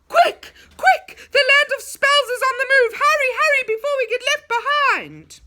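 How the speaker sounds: high, loud urgent cries; panicked shout. A narrator's reading. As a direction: yell to hurry everyone before it's too late. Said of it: "began to shout".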